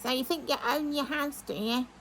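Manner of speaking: high, cackly witch imitation; cackly old witch voice. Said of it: "she goes this cackly old witch voice".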